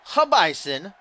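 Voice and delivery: put-on high-pitched Italian-accented name call. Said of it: "said that in an Italian accent".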